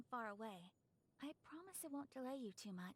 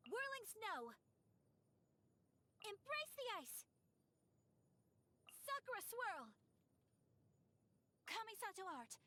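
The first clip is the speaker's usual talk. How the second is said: much higher, ringing attack calls; battle cries; 10 semitones higher than usual. Acted. Swordswoman calls out her sword techniques in battle, high cries. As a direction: shout each move name as you strike. Combat skill callouts.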